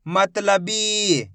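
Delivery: मतलबी very slow, final बी held; teacher drawing out मतलबी; blended slow reading. Shown as a demonstration. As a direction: join मतलबी slowly, stretching the ee. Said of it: "एक ही अक्षर का उच्चारण करके".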